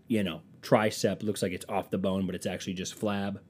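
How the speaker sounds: normal talking voice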